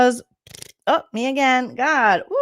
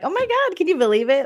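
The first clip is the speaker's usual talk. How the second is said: mock innocent gasp, pitched way up; playing dumb; 8 semitones higher than usual. Vlogger mimics a houseguest playing dumb, high mock gasp. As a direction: fake shock, high and gushing. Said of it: "playing dumb".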